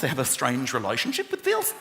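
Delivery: ordinary delivery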